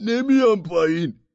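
loud, high defiant refusal; stubborn madman. A narrator's reading. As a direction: shout down a flat no from the roof.

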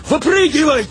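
high-pitched shouted order; alarmed cry, pitched high; urgent bail-out shout. A narrator's reading. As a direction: yell for them to jump out. Order to bail out of burning vehicle.

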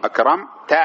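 "أكرمت" ending in a clear -ta; demonstrating fatha. Shown as a demonstration. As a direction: separate the final -ta of "أكرمت". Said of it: "فتقول مثلًا". ("أكرمت" بفتح التاء)

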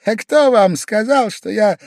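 high, loud sudden retort; sprung retort. A narrator's reading. Pavel Pavlovich springing back at Velchaninov, high and loud. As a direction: jump out like a spring. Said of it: "выскочил опять".

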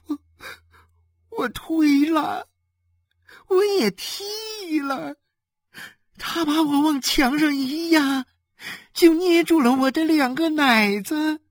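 tearful pleading. Acted. Beaten wife explains to her husband, weeping. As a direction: sob out your defence haltingly.

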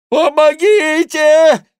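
very high, long scream for help; monk's cry for help. A narrator's reading. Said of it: "кричал монах". (протяжный вопль о помощи)

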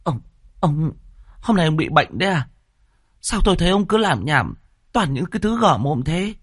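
high-pitched, stammering disbelief at first; stunned. A narrator's reading. Wife asks raving husband if he's ill, stunned. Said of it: "Bà địa chủ Lân sừng sốt".

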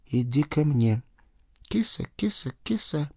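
soft, high kitty-calling; wary, quiet coaxing; coaxing a cat. A narrator's reading. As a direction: lure it closer softly. Nephew coaxing the cat to approach.

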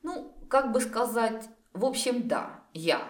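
hesitant, cautious admission; sheepishly apprehensive; wary. A narrator's reading. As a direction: confess slowly and warily, stalling first. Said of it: "опасливо отозвался Дарин".

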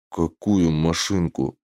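low, slow, puzzled question; understated bafflement in a low voice; bewildered. A narrator's reading. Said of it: "Иголас растерялся".

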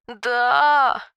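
wailing sob; loud sobbing. A narrator's reading. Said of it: "втрое громче зарыдала".

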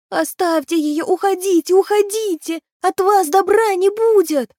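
high, agitated creature squeal; thin little voice. A narrator's reading. Trembling creature driving children from the keener, high agitated cry. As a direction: shoo them off in a high, urgent pipe.

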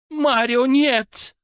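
high, loud alarmed cry; frightened cry. A narrator's reading. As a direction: scream to stop him. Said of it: "вскрикнула женщина".

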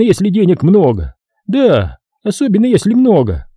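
высокий голос разглагольствующего доктора; вещай свысока, заметно выше своего голоса; вещающий доктор. A narrator's reading. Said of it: "всё это время доктор вещал". (доктор вещает высоким голосом)

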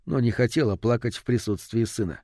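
ordinary delivery, nothing marked

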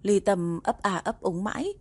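ordinary delivery, nothing marked

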